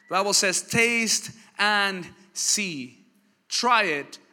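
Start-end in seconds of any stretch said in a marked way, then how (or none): 0.6-3.1 very slow, drawn-out "Taste and see"; savouring the words. Acted. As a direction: let each word linger. Preacher savours the verse.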